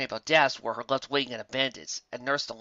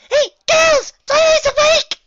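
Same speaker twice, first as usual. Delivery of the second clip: much higher and louder squeal; joyful squeal; 16 dB louder, slower than usual. Acted. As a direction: burst with bouncy delight. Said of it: "Pinkie squealed with joy".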